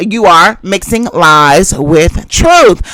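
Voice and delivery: every word dragged out slowly; slow accusation. Real, not acted. Accusing her in an argument.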